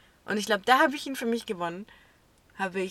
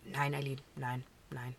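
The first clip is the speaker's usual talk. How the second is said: lower, softer, a hint of a calm strict teacher; lightly voiced teacher; 7 semitones lower, 10 dB quieter than usual. Teacher waving off the pupil's excuse, lightly voiced, lower and softer. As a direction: brush her off gently as the teacher.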